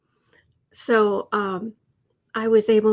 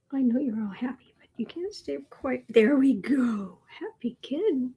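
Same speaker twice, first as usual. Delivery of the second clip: whisper. Real, not acted. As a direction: whisper so the cat stays calm. Whispering to the cat.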